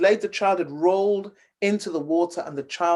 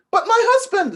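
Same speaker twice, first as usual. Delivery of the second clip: high, louder cry of disbelief; distraught protest; 14 semitones higher, 6 dB louder than usual. Acted. Cast-out wife protesting to the servants, distraught. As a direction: plead as the doors close on her.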